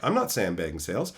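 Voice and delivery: low, soft, deadpan denial; cool denial. Retold or imitated. Telling the boss he did nothing wrong, low deadpan. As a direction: play it innocent and calm. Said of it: "I'm like".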